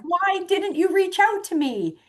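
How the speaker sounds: high-pitched indignant protest, acted out; retold upset. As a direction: play the hurt, cross friend. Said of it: "He was mad. He was upset".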